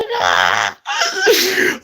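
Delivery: high shrieking laugh; loud laugh. Real, not acted. Recalling the winnings to friends, loud high laugh. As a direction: burst out laughing, delighted. Laughing at his big win.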